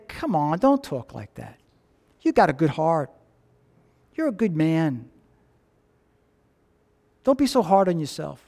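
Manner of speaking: soft, low reassurance; comforting words. Acted. Imagined Jesus comforting the dying thief, soft and low. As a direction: ease his guilt gently.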